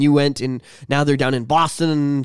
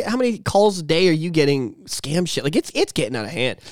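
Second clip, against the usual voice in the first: usual speaking voice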